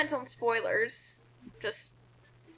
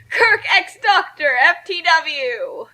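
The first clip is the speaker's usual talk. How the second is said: slow, loud, higher triumphant cry; mock triumphant cheer; 4 semitones higher, 9 dB louder, slower than usual. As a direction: shout it like a fangirl victory chant. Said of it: "That does not say for the win".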